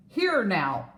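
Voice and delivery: a touch of an irritated snort; angry snort. Acted. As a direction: snap at the vine. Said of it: "snorted the giraffe with an angry kick".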